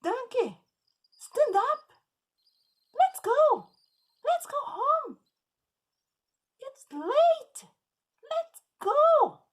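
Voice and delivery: put-on high, loud, furious child yelling; angry boy. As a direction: scream at the stubborn donkey, near tears. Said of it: "very angry".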